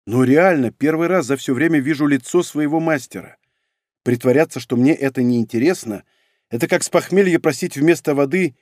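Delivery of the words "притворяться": plain reading voice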